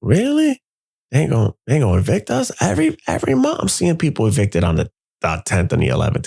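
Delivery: high-pitched disbelieving question; incredulous, pitch leaping up; baffled. Retold or imitated. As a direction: blurt it out in retold disbelief. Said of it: "And I'm baffled".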